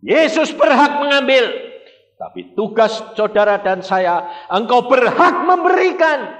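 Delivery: full-force preaching. Acted. Preacher proclaiming to the congregation, high and loud. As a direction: proclaim it from the pulpit.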